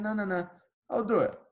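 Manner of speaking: high, excited no-no-no; eager insistence. Retold or imitated. Answering gym owners who say think it over, high eager insistence. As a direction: jump in eagerly before they can back out. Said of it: "I said".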